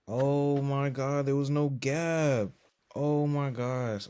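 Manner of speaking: dismayed outcry. Real, not acted. Trader realizing there was no gap, dismayed outcry.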